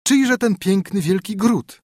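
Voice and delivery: high, slow wondering question; princess asking. A narrator's reading. Princess asking the minstrel about the city, high and wondering. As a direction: marvel at the great city. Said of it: "pyta królewna".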